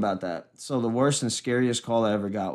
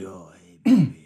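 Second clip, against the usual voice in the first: sleazy phone caller; 4 semitones lower, 5 dB louder than usual. Acted. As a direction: murmur it low like a lecherous dude. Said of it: "the dude's like".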